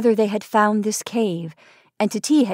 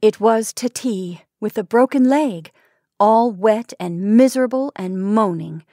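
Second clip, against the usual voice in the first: ordinary delivery, nothing marked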